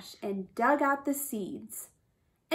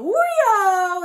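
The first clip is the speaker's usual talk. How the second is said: high, loud swooping whoop; flying whoop; 8 semitones higher, 9 dB louder than usual. A narrator's reading. Narrator as rabbit flies into forest, whooping. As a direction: send the sound sailing away.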